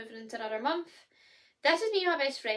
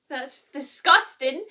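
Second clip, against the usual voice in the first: laughing disgust; 3 semitones higher, 11 dB louder, much slower than usual. Real, not acted. Laughing at her coughing.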